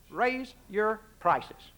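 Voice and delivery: drawn-out "Raise your prices", very slow; slow mock quote. Mocking the president's curt answer, slow and drawn out. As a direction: hand down the president's answer slowly. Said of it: "What was the president's answer".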